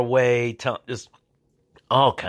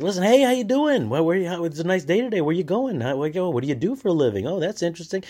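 much higher, chatty small talk; overly friendly cop; 8 semitones higher than usual. Retold or imitated. Highway cop chats up the driver, much higher. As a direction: sweet-talk the driver before the catch.

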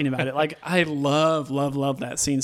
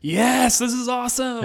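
much higher, gushing with delight; thrilled; 9 semitones higher than usual. Retold or imitated. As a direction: cheer it like a fan hyped in the theatre. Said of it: "I'm just like".

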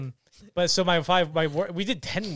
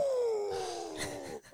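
sharp gasp; 9 dB quieter than usual. Retold or imitated. Shocked by scene.

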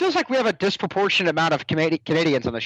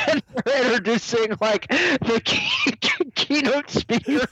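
higher voice, breaking into laughter mid-story; cracking up; 7 semitones higher than usual. Real, not acted. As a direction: struggle to get the story out through laughter. Cracking up telling the Gary story.

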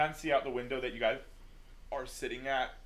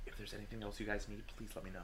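softer, quicker steward voice; soft kind offer; 7 dB quieter, faster than usual. Acted. Steward softly telling upset Lola to ask for anything. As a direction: quietly offer help to the upset girl. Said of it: "looks down at you, Lola, directly and goes".

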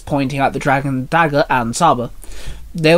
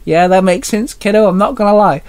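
higher-pitched chuckle leading into amused speech; chuckling; 5 semitones higher, 3 dB louder than usual. A narrator's reading. Dad amused by young Minnie's sandwich idea, chuckling. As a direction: chuckle first, then speak up with amusement. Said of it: "Mark chuckled".

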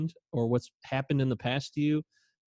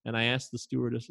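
ordinary talk, nothing marked

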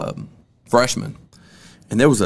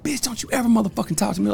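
retold cussing out; 10 semitones higher, 6 dB quieter than usual. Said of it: "I was cussing".

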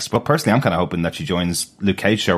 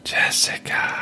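voiceless, slow, drawn-out whisper of Jessica; spooky mock whisper; eerie whisper; 3 dB quieter, much slower than usual. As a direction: breathe the name like a haunting voice.

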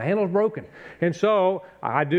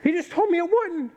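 laughing disbelief. Retold or imitated. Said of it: "laughing incredulity".

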